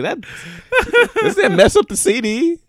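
high, laughing question; laughing disbelief. Retold or imitated. Kid watching Batman, laughing in disbelief. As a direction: ask it through a giggle. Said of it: "I was like".

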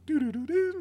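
high, quiet hummed chime melody; hummed movie chime. Retold or imitated. Imitating the movie's chime for friends, hummed high and soft. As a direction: hum the Back to the Future chime. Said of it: "it was like a Back to the Future".

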